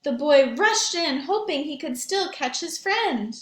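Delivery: put-on lively read-aloud for young children; story narration. A narrator's reading.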